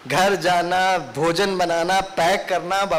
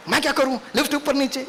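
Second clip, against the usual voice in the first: angry excuse; 6 semitones higher than usual. Retold or imitated. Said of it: "गुस्से में बोल रहे".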